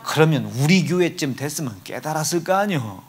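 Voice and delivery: ordinary talk, nothing marked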